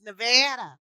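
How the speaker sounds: "Nevada" with a flat A as in cat; pronunciation correction. Shown as a demonstration. Correcting friends on the state's name, "Nevada" as nuh-VAD-uh.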